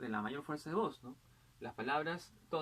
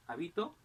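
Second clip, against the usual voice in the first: habito said ha-BI-to; stress on middle syllable; 2 semitones higher than usual. Shown as a demonstration. Teacher giving accent examples, habito stressed on BI. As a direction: move the weight of habito onto BI. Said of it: "el acento puede variar".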